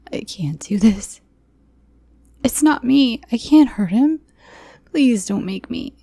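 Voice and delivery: high, loud tearful refusal; begging in panic; desperate plea. Acted. Captive pleading with the man not to make her.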